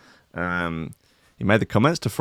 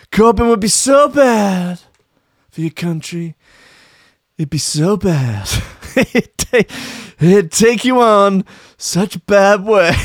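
higher, slow, louder Trump repeating so bad; Trump impression; 8 semitones higher, 4 dB louder, slower than usual. Trump warning Farage about Corbyn, higher slow voice. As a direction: drawl it out like Trump. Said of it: "he talks like this".

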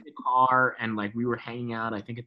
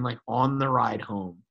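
ordinary talk, nothing marked

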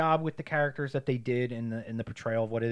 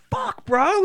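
high, loud startled yelp; mock pained yelp; 7 dB louder than usual. Girl slapped too hard by a gym guy, high pained yelp. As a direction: yelp like you got walloped.

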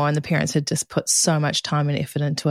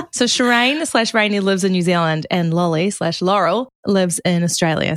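ordinary delivery

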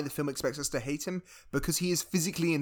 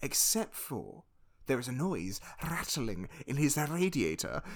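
ordinary talk, nothing marked

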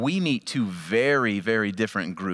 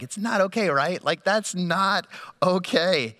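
indignant; 5 semitones higher than usual. Retold or imitated. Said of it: "I'm just like".

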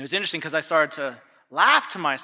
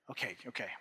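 resigned dad; 9 semitones lower, 18 dB quieter, faster than usual. Retold or imitated. Dad giving in.

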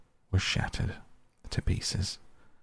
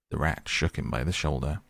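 ordinary delivery, nothing marked

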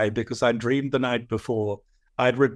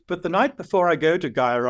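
usual speaking voice

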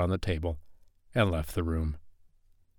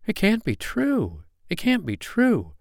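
put-on high, disbelieving child's wail; anguished boy refusing the news; crying out; 12 semitones higher than usual. A narrator's reading. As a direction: cry out in dismay, pitch falling on the last word. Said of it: "he cried".

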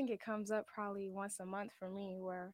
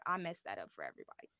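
much lower and fast, a mock defeated groan; mock self-blame; 11 semitones lower, faster than usual. Player imitating herself after a loss, much lower, fast, mock dejected. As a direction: throw it away like a guilty teammate.

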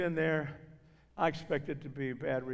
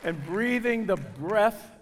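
slow emphasis; 5 semitones higher, 8 dB louder, slower than usual. Real, not acted. Preaching after climax.

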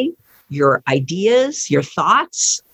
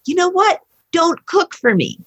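higher-pitched retold defiance; indignant; 10 semitones higher than usual. Son refusing the parent's cooking bargain, indignant. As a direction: imitate a fed-up son, pitch raised.

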